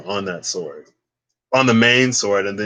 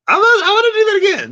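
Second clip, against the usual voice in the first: much higher, louder and fast; excited; 8 dB louder, much faster than usual. Real, not acted. Thrilled after Siri answers, excited squeal. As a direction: rush it out in delight.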